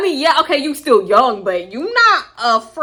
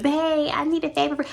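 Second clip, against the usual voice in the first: high, soft mock-girlfriend wheedle; fluffy sweet-talk; 5 semitones higher, 5 dB quieter than usual. Friend cooing to her man for a favor, mock sweet-talk. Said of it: "She went from tough to fluff".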